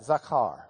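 "Zakar" repeated low, ZAH-kar; repeating zakar. Shown as a demonstration. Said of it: "Say that".

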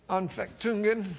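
Anfechtungen said with a weary shrug; offhand, resigned Anfechtungen; shrugging reply. Pretend. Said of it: "kind of shrug your shoulders and say".

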